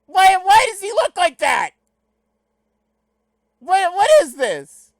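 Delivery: high, very loud outraged shriek; incredulous yelling. Real, not acted. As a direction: yell in mock outrage at the screen. Yelling in disbelief at movie clip.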